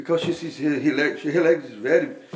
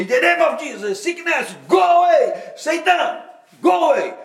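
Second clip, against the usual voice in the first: much higher, louder commanding cry, faster at the start; bold rebuke; 9 semitones higher, 4 dB louder than usual. Retold or imitated. Preacher casting out sickness from the woman's leg, loud rebuke. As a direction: order the sickness out with full force. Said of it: "I now have more courage".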